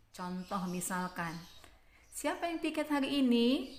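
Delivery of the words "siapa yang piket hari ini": high-pitched question lifting on "hari ini"; "ini" lifted up as a question; rising question. Shown as a demonstration. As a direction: ask it high, raising the end on "ini". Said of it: "intonasi di akhir itu akan meninggi".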